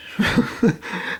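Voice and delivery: short unvoiced laugh; breathy laugh. Real, not acted. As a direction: let out a breathy chuckle. Laughs at the memory.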